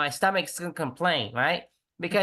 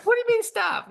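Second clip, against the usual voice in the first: higher, louder put-on retort; hammed-up wife voice, pitched much higher; mock indignant wife; 5 dB louder than usual. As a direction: squeak it back, offended. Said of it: "quoted wife's retort".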